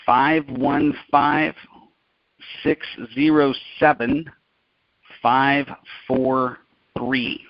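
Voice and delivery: slow, measured digits with long pauses between groups; slow dictation. Real, not acted. Host dictating a meeting number to listeners, slow dictation. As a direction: read the digits out slowly, in spaced groups.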